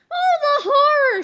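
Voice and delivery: high, loud mock cry; hammed-up horror. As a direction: overplay the horror. Comic panel exclamation.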